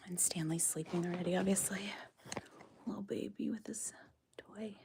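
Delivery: soft whisper so as not to wake him. Real, not acted. Whispering near a sleeping alpaca.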